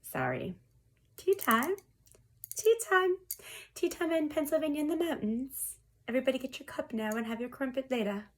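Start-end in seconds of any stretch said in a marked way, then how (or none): 1.2-8.3 high, singsong tea call; mock tea-time announcer. Tarot reader summoning viewers to tea, put-on high voice.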